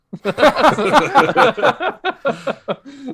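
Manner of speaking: long, loud, high-pitched laughing fit; real high cackling laughter; big laugh. Real, not acted. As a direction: crack up at your own story. Payoff of the retold pizza argument.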